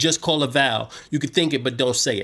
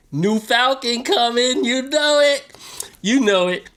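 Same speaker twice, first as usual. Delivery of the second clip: hype; 11 semitones higher, 3 dB louder than usual. Real, not acted. Hyping the new Falcon.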